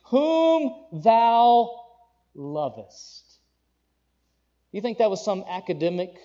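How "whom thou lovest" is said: slow, louder and higher, a grave proclamation; God's solemn command. Acted. Preacher voicing God to Abraham, slow solemn command. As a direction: speak as God laying down a heavy command, drawn out.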